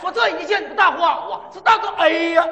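偏高叫卖，半句突然喊出"哎呀"; 唱到一半猛地大喊"哎呀"吓对方; 东北吆喝. Pretend. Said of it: "咱们来一回". (东北吆喝，半截突然大喊"哎呀")

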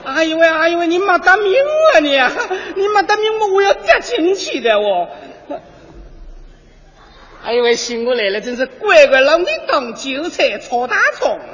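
put-on Yesenia dubbed loud in a Yangzhou accent. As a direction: scold him in Yangzhou dialect. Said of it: "我就是扬州的叶塞尼亚".

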